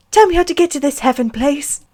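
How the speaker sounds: put-on high little-boy voice, wistful; hammed-up wistful kid; wistful child. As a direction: plead wistfully as a small child. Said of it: "Harry cried wistfully".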